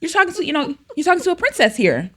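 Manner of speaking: a playful hint of sass about princess; half-joking warning to the boys; mock princess scolding. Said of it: "I'm like".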